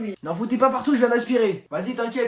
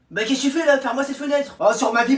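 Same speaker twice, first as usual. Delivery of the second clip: ordinary delivery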